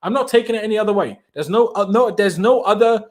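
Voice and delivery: ordinary talk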